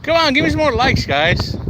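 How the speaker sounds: high, pleading whine; begging for likes. Acted. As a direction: beg the viewers like a kid.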